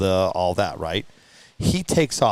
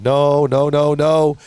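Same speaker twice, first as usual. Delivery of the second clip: louder reenacted disbelief; dismayed denial; 4 dB louder, slower than usual. Told it's in a million pieces, dismayed denial. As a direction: build into emphatic, repeated refusal. Retold disbelief at the broken truck.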